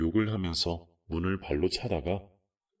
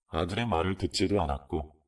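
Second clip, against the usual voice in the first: plain reading voice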